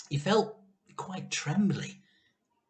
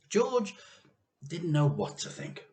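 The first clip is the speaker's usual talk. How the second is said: ordinary delivery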